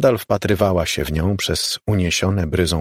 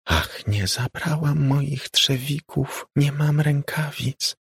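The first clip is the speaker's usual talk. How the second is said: ghostly whisper; 8 semitones higher, slower than usual. A narrator's reading. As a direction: moan it like wind over water. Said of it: "Szept spotężniał".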